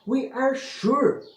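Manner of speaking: put-on children insist to their father, a high slow cry; high slow insisting shout. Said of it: "the children screamed".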